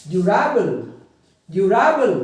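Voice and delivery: Durable with RA punched loud; durable said du-RA-bal, middle syllable stressed. Shown as a demonstration. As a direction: hit the RA in durable. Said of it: "अपनी आवाज़ को stress देना है".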